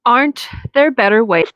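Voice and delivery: ordinary talk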